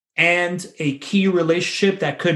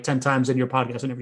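ordinary talk, nothing marked

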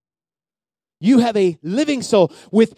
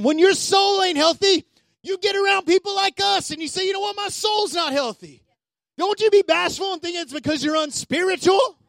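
higher, impassioned pulpit preaching; full-force preaching; 7 semitones higher than usual. Acted. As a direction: preach it out with fire.

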